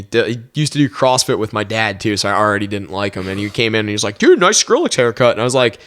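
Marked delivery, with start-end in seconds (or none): 4.2-5.3 mocking taunt. Kid walks in at work and mocks the haircut, taunting. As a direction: imitate a needling coworker, higher pitched.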